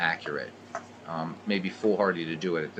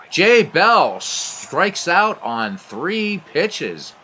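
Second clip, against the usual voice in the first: slow, higher, louder emphatic call; drawn-out excited announcer call; excited strikeout call; 9 semitones higher, 8 dB louder, much slower than usual. Acted. Strikeout called live.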